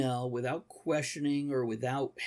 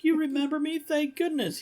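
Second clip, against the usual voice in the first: much higher, hopeful relief; relieved man; 3 dB louder than usual. Retold or imitated. Man greeting the robot that remembers him, high relieved voice. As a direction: squeak it out with relief. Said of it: "And he's like".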